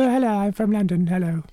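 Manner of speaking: high-pitched mock posh London accent; hammed-up plummy English greeting. Radio host mocks a co-host's posh Cheshire accent, posh English. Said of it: "it's in a kind of a posh English".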